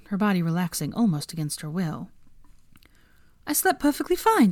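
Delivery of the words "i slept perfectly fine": high defensive reply; pitched-up indignant; higher woman voice. Acted. Hermione voiced.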